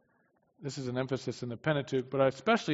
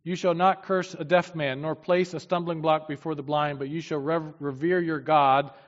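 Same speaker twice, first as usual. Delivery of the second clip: louder, solemn Bible reading; preacher reading God's words to the congregation, louder and solemn; scripture reading; 2 semitones higher, 5 dB louder than usual. Acted. Preacher reads Leviticus to his congregation, solemn and louder.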